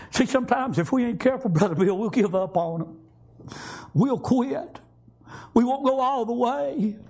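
high, driving exhortation; fervent preaching. Real, not acted. Preacher warning the congregation not to quit, fervent and fast. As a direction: push it up and fast to warn the church.

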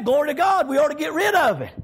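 normal talking voice

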